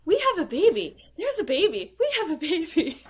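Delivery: put-on new father laughing over the baby; imitating Barry's joy. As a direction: play the overjoyed new dad laughing. Repeating the joyful cry.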